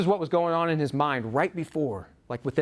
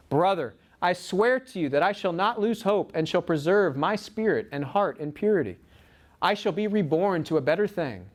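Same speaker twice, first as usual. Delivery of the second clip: earnest, raised-voice reading of a heartfelt letter; 4 semitones higher than usual. Retold or imitated. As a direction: read a brother's letter with rising fervour, high. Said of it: "He says this. This is a part of that letter".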